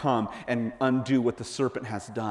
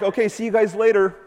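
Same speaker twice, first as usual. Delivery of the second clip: breezy, higher see-you-later; casual goodbye; 8 semitones higher, 4 dB louder than usual. Pretend. Jesus breezily leaving the disciples behind. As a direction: wave them off lightly.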